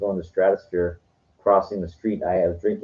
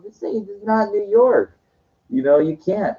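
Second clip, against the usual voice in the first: much higher, stern mock cop voice; imitating the cop; 12 semitones higher than usual. Cop telling the New York friend off, mock stern higher voice. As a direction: play the cop laying down the law, hammed up.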